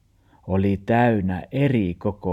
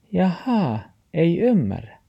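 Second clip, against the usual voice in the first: high dog voice; 10 semitones higher than usual. Acted. The dog answers grandpa in a high voice.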